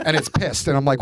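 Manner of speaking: ordinary talk, nothing marked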